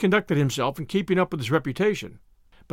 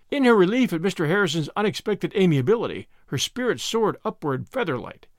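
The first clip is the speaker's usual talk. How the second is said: plain reading voice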